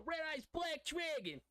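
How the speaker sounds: put-on high, slow, loud New York accent yell; New York accent shout. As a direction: belt it like a New York anime duelist. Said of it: "hearing of the New York accent would be Joey from Yu-Gi-Oh!".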